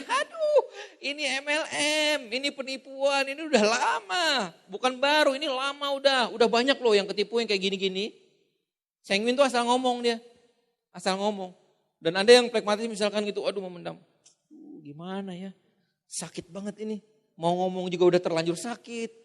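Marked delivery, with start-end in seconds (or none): 0.0-8.2 mocking high shrill rant. Sanguine prospect accusing scam. 13.5-19.1 much softer pained inner voice; hurt murmur. Retold or imitated. Pragmatist hurt inside.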